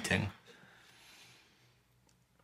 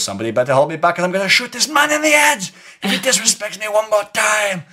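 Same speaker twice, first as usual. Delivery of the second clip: high, loud hammed-up threat; blustering retold yell, rising to a peak; shouted threat; 12 semitones higher, 7 dB louder than usual. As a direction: shout it like a hothead begging to be held back.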